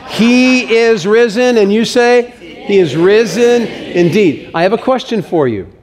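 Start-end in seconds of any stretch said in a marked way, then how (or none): 0.1-1.6 rousing Easter call; proclaiming shout. Shown as a demonstration. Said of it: "here's how it goes". 2.4-4.5 congregation response. Shown as a demonstration. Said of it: "help with the script".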